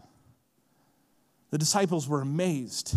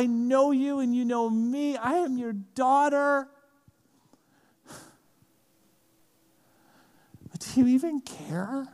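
pleading; 5 semitones higher than usual. Acted. Acted anguished prayer to Jesus.

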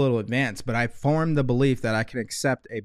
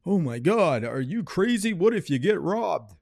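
higher, slower pearl-clutching mock alarm; mocking worried commenter; 5 semitones higher, slower than usual. Mocking critics who fear robbery, in a higher, slower, shocked whine. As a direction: ham up a shocked critic's panic. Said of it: "before the people who have F S L comment".

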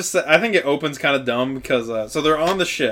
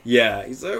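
usual speaking voice

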